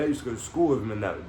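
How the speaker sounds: usual speaking voice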